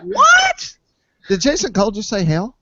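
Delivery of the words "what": shouted what, far higher and louder; shocked shout. Retold or imitated. Hearing the Mojo sixty named, shouted shocked what. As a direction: scream it out, flabbergasted. Driver names his favorite plane.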